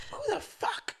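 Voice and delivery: high falsetto mock outrage; squeaky impression of an indignant girl; imitated angry girl. Girl catching the shot thief, mocking falsetto. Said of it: "the girl was like".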